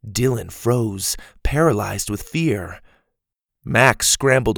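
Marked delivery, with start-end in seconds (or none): none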